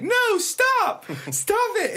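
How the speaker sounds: high panicked yelling at a dog; frantic screaming. Retold or imitated. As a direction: imitate a terrified woman pleading. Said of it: "she keeps screaming more frantically".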